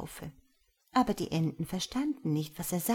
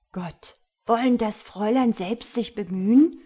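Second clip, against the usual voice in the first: put-on polite surprised boy; 4 semitones higher, 7 dB louder than usual. A narrator's reading. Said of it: "sagte Hjalmar".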